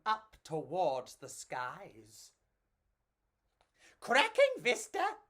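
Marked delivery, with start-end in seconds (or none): 4.0-5.2 high squealing voice. Acted. Enrico squealing at the view, trying the lingo. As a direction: squeal it with delight. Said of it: "Enrico squealed".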